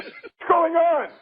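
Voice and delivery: put-on puzzled child in a joke. Little kid to his parents in a joke, puzzled. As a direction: ask it high, as the startled kid.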